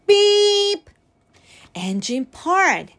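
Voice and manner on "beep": high, loud, held truck-horn beep; bright held toot. A narrator's reading. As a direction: imitate a little horn on one steady note. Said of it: "Horn went".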